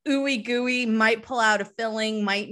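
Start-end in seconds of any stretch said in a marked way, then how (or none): none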